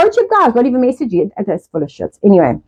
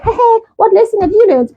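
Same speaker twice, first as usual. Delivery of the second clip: chirpy spirit voice; 7 semitones higher than usual. Retold or imitated. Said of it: "in the spirit world, we all like".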